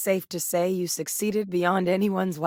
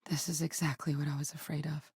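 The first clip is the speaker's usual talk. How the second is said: quiet dread; 2 semitones lower, 10 dB quieter than usual. Acted. Speaker finding her fears confirmed, quiet dread. Acted dread at bad discovery.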